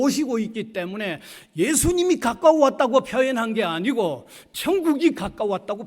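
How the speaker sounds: high, insistent pulpit voice; emphatic preaching. Real, not acted. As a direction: argue it forcefully to the congregation.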